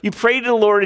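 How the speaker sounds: ordinary talk, nothing marked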